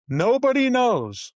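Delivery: pitched high and slow, exasperated; nobody knows, dragged out high. Real, not acted. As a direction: throw it up high and draw it out. Exasperated exclamation.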